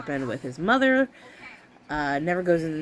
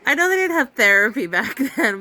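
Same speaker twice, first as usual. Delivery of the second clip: ordinary talk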